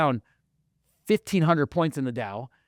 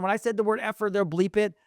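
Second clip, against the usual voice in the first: ordinary talk, nothing marked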